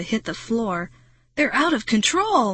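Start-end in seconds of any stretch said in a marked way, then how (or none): 1.4-2.5 higher-pitched, mildly panicked cry; restrained shriek of alarm; Marty shrieking. A narrator's reading. Marty as the insects tighten the circle, shrieking. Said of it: "Marty shrieked".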